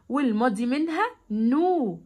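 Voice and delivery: ordinary delivery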